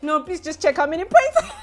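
laughing while begging the teller; laughing plea. Retold or imitated. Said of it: "I'm like".

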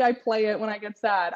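ordinary delivery

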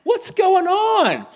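sing-song put-on hello; fake cheery phone voice. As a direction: chirp it like nothing is wrong at home. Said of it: "someone calls on the phone like".

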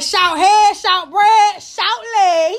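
loud, high-pitched rhyming roast; mocking taunt. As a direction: fire each nickname out like a playground taunt. Roasting him with a string of nicknames.

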